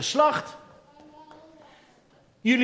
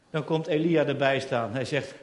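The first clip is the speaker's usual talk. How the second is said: ordinary delivery, nothing marked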